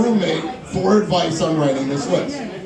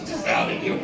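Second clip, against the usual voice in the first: laughing; 6 dB quieter than usual. Real, not acted. Laughs after outing roommate.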